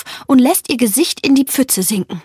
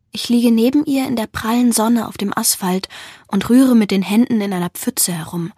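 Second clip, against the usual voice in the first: plain reading voice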